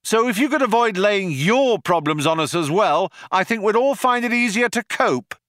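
high-pitched call across to attackers; Ford shouting out. A narrator's reading. Ford yells back at the cops shooting at them, shouting out. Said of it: "Ford shouted out".

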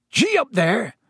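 high-pitched hearty call to animals; roared call. A narrator's reading. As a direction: roar it out to drive the beasts on. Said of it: "he roared".

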